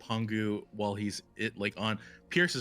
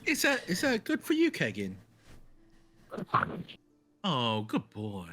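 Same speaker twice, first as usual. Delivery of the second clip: pet talk; 3 semitones higher than usual. Acted. Praising her new creature.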